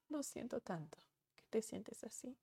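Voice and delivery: hushed, comforting whisper; soft whisper. Acted. Parent soothing frustrated daughter, in a whisper. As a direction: whisper it gently to the child. Parent consoling upset child.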